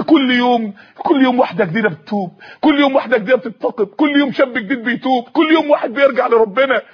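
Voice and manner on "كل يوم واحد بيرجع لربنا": normal talking voice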